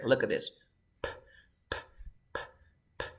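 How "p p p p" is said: "p" released as pure air; unvoiced P sound. Shown as a demonstration. Teacher saying look at this, P as bare puffs of air. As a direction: repeat "p" as separate voiceless puffs.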